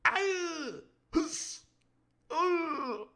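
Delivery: high falsetto ow and grunt, laughing; hammed-up death cries. Reader voicing the squashed crew member, hammed-up yelps. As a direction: ham up a dying extra's cries. Reader hams up dying henchman.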